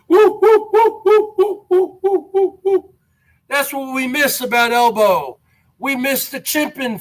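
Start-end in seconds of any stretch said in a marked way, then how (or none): 0.1-2.9 high, loud chimp hooting; mock chimpanzee woo-woo calls; chimp hoots. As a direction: hoot like an excited chimp in the van. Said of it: "in his van going like this".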